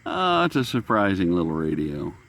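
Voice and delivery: ordinary talk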